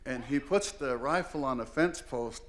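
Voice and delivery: ordinary delivery, nothing marked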